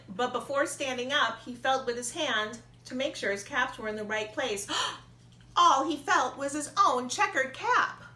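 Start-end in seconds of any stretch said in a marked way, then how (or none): 5.1-8.0 gasp, then rise high and louder; shocked discovery; sudden alarm in the telling; surprised gasp. A narrator's reading. Peddler discovers caps gone.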